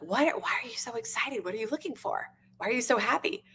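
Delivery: high, soft mock bewilderment; puzzled observer. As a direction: lightly act out a baffled onlooker asking. Puzzled observers voiced in a story.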